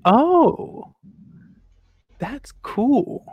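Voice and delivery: high, thin showy artist voice; airy celebrity putting on charm; Gordon's voice. Acted. Gordon Del Toro greeting the star-struck apprentice, high and airy. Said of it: "And so Gordon Del Toro goes".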